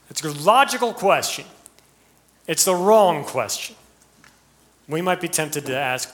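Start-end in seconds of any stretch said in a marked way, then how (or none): none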